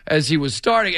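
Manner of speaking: normal talking voice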